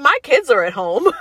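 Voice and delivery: worried mom protesting to the nurse; flustered protest. Retold or imitated. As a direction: blurt it out in a panic.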